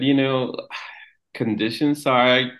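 usual speaking voice

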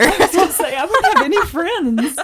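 loud, high, laughing words. Real, not acted. Parent joking about losing friends over air horn, laughing. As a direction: laugh your way through it.

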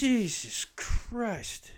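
drawn-out disbelief. Retold or imitated. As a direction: draw it out in mock shock. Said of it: "And I was just like".

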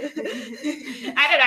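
slow, laughing through the words; giggling it out. Real, not acted. Laughing about mispronounced names.

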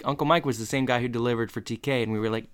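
ordinary delivery

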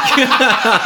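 high loud laugh; burst of laughter. Real, not acted. Husband laughing recalling arguing with wife. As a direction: laugh at yourself.

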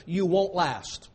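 ordinary talk, nothing marked

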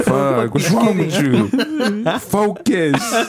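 high, very slow exasperated yell through laughter; laughing mock outburst at the driver; retold yell at sister. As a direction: scold her to focus, half laughing. Retold yelling at sister driving.

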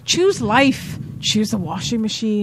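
put-on quoting Trainspotting in a Scottish accent; light Scottish accent, mock film speech. Friends riffing on Trainspotting in a Scottish accent. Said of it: "[name]'s doing a Scottish accent".

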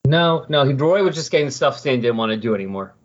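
ordinary delivery

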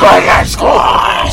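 slow loud drawn-out callout; shout. Acted. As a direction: belt out the segment name slowly. Echoing segment call.

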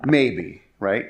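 usual speaking voice

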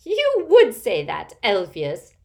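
put-on loud, high cackling old aunt. A narrator's reading. Aunt scoffing at Elphias, loud cackling taunt. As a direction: crow it with drawn-out mocking relish. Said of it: "cackled Auntie Muriel".

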